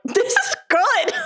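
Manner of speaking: high, slow, laughing disbelief; amazed reaction. Retold or imitated. Retelling her reaction to an actress's accent, amazed. Quotes own surprise at the accent.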